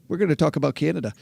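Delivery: quick, hammed-up Canadian accent; deliberately bad Canadian accent played for laughs. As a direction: rattle it off in a jokey fake Canadian accent. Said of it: "that's my bad Canadian accent".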